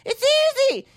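high, far-off preachy know-it-all voice; high mocking voice, scoffing at the struggle. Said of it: "They're just like".

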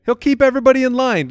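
ordinary talk, nothing marked

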